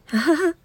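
quick high chuckle; real laugh. Real, not acted. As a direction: laugh off your slip. Amused after blurting a spoiler.

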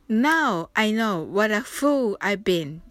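Now I know what a fool I've been said slowly and carefully; pronunciation demo. Shown as a demonstration. Teacher demonstrates the line for students, slow and clear. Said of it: "ちょっと発音してみましょう".

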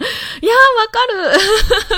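high, loud fit of laughter; big helpless laugh. Real, not acted. Host laughs hard at her own speech habit. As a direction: crack up at yourself.

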